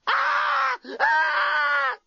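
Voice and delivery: shrill repeated screams of panic; screaming uncontrollably. A narrator's reading. Lucy panics at the alien, screaming nonstop. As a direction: let the screams run wild. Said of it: "screaming uncontrollably".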